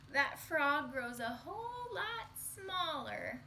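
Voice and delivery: slowed, drawn-out reveal; slow storytelling. A narrator's reading. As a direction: let the twist land slowly. Slow punch line.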